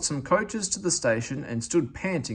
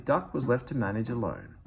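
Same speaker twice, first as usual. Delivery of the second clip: ordinary delivery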